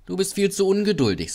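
high-pitched teasing; mocking. A narrator's reading. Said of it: "Die anderen Loks lachten".

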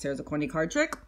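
soft, low droning principal; lightly voiced boring principal; dull principal. Principal's card trick.

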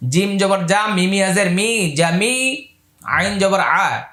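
normal talking voice

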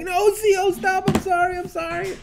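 high, pleading inmate voice; begging inmate. Retold or imitated. Beaten inmate begs the guard, high pleading. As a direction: beg to stop, high and pleading. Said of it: "And he's like".

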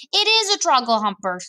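exasperated storybook giant. A narrator's reading. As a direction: insist with mild exasperation. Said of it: "cried the exasperated B F G".

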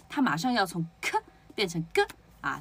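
ordinary talk

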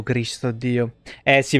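ordinary delivery

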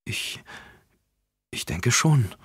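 hesitant, halting start; unsure. A narrator's reading. Carol unsure when asked to stay the weekend, hesitant. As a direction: let the words falter a little. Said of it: "antwortete Carol unsicher".

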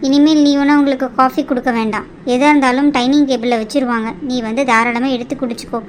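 usual speaking voice

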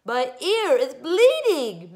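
high, loud, slow crybaby whine; snuffling. A narrator's reading. Girl claiming a hurt ear, snuffling wail. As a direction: whine through the sniffles. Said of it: "Melanie snuffed".

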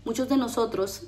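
ordinary delivery

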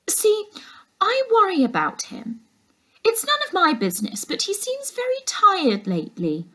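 nosy housekeeper pretending to care about the uncle; fake-concerned housekeeper. A narrator's reading. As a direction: ooze phony concern.

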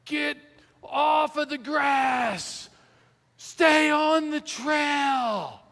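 high, drawn-out imitation of a far-off shouter; distant yelling. Stranger hollers at a hiking family from far away, yelling. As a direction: yell it long and high, mimicking an irate stranger. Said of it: "this person starts yelling".

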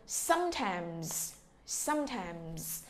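"Sometimes" slow and soft, SOME stressed; slow model word. Shown as a demonstration. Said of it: "Và từ sometimes".

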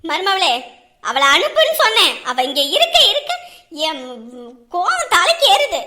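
loud furious command; angry shout. Acted. Aunt demanding the daughter be sent off, angry shout. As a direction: shout for her to be sent away.

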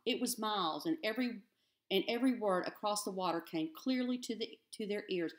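ordinary delivery

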